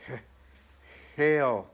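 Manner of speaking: stuttered start, then a loud forced 'Hail'; stumble, then burst out the word; stammering devil. Acted. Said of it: "Then Satan stammered".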